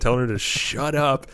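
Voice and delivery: usual speaking voice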